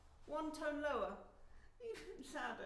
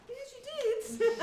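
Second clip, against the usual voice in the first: laughing tease; 12 semitones higher, 9 dB louder, much slower than usual. Real, not acted. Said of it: "laughing teasing reply".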